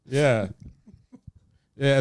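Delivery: ordinary talk, nothing marked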